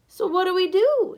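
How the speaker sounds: fighting back panic; near panic. A narrator's reading. Boy asks the father what to do, near panic. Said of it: "He was trying not to panic".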